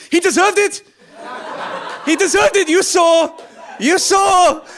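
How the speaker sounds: high, very loud outraged yelling; shouting. Acted. Driver justifying himself to the passenger, high shouting. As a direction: scream it in frantic self-justification. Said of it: "like, shouting at me".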